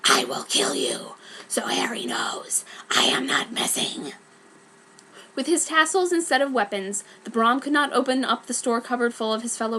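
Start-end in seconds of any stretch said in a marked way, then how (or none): 0.0-4.1 much lower whispered menace; Brom's threat. Acted. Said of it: "Brom said".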